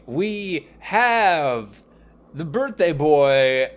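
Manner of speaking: upbeat announcer read, bright and lifted; hammed-up radio host opening; podcast host intro. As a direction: welcome the listeners like a show host. Host introduces next guest.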